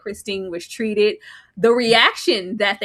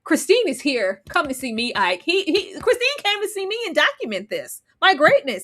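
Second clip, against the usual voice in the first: higher, boastful mock arrogance; arrogant man; 7 semitones higher than usual. Sam scolds the women, pompous. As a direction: puff yourself up, indignant. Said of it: "He berated me and was like".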